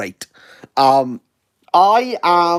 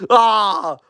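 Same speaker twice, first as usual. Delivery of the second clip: usual speaking voice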